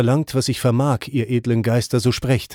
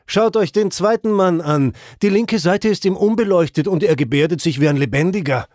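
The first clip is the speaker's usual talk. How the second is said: calling out; 6 semitones higher than usual. A narrator's reading. A soul in Purgatory calls out, alarmed, to the others. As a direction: point and cry out to the others. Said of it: "die eine rief".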